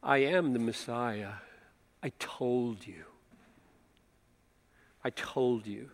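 low, soft and insistent; hushed repeated claim; quiet. Acted. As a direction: say it gently but firmly. Jesus voiced, quiet and low.

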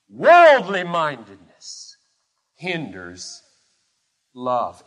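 slow, low, weighty phrases with long pauses; deep conviction. Real, not acted. Preacher restating the thesis, with deep conviction. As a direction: press each word home, then drop. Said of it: "with all the conviction that lies within me".

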